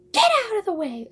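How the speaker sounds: high, loud retold scream. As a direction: shout them out of your way, high and loud. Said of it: "and I screamed".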